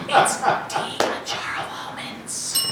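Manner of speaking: soft, slow ad whisper; whispered sponsor tag. Acted. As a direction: breathe out the sponsor line.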